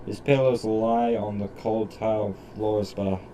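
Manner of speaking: usual speaking voice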